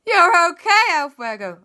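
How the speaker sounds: very high loud gasp of relief; overjoyed he lives; relieved joy. Acted. Everyone finding El Fuego alive, joyful relief.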